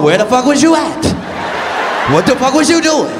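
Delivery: indignant friend firing accusations; mock interrogation. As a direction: grill them like an offended buddy on the phone. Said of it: "these motherfuckers will interrogate you".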